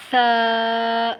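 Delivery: سا with a soft lisped "th", vowel held long; soft, drawn-out ثا in place of سا; soft tha. Shown as a demonstration. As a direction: say سا gently as "thaa", sustained. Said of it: "سا کو آپ لوگوں نے نرمی سے پڑھنا ہے".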